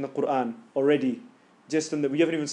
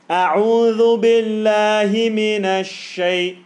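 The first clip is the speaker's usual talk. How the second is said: slow chanted recitation, louder; Quranic recitation; 5 semitones higher, 7 dB louder, much slower than usual. Shown as a demonstration. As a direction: draw out "A'udhu" with a strong sh in "minash". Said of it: "The sheen has to be sh".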